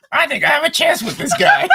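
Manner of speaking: high cartoon teen girl; high girly voice. Quoting a Teen Girl Squad girl.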